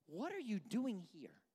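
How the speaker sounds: slow, pointed, incredulous question; exasperated God. Acted. God confronting Elijah in the cave, slow exasperation. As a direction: draw the question out in disbelief. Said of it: "I think that's God being like".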